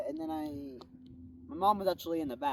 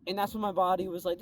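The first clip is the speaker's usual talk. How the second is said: ordinary talk, nothing marked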